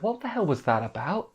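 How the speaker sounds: a hint of the heroine, confused alone in her room; lightly voiced puzzled girl. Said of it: "she's in her room like".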